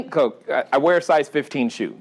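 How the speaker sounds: ordinary talk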